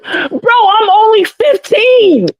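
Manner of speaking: high, slow pleading whine; high exasperated whine. Retold or imitated. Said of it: "I was like".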